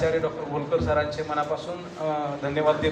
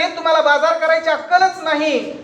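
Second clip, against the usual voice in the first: higher scolding wife; 9 semitones higher, 3 dB louder than usual. Acted. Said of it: "ती म्हणाली".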